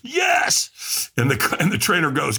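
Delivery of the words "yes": imitating a yell at the top of the lungs, much higher; screamed yes. Ticked-off firewalker answering the trainer, full scream. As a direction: throw your arms up and scream it out. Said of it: "I screamed at the top of my lungs".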